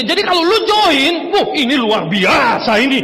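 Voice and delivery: loud, high mock-hyped pitch; imitating over-hyped presenter. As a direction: shout it like an over-excited presenter. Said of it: "yang presentasi yang jika".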